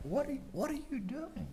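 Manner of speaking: very high, softened questioning; a small, high voice of acted bafflement; bewildered plea. Believer asking a silent God, bewildered pleading.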